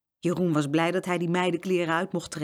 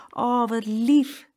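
put-on girl cooing; 6 semitones higher, 4 dB louder, much slower than usual. Saskia coos over the piglets, slow and tender. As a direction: melt at the sight of the piglets. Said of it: "zei Saskia".

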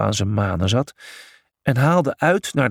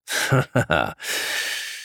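zachtere, lage lach; 8 semitones lower, 3 dB quieter than usual. A narrator's reading. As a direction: lach laag en ingehouden. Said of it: "lachte Heracles". (lage, zachte lach)

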